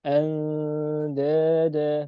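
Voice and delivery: Andada very slow, with a light, thin nasal before the d; thin ikhfa. Shown as a demonstration. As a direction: stretch Andada slowly and keep it light. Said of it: "tapi dia tipis". (Andada dibaca tipis dan sangat pelan)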